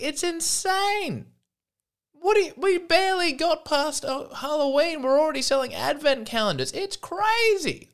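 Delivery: very high, squeaky comic rant; comically worked-up, high-pitched complaining; hammed-up outrage. Hammed-up rant on early holiday goods.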